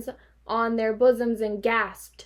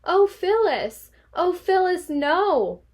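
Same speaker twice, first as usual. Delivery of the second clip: high, slow, louder pleading; despairing plea; 8 semitones higher, 4 dB louder, slower than usual. Acted. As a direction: beg her in squeaky despair.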